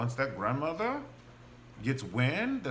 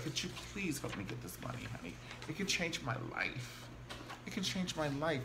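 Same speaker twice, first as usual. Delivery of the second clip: imitating soft pleading; mock begging; 4 semitones higher, 8 dB quieter than usual. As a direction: beg softly, half in jest. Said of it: "Candace was begging her".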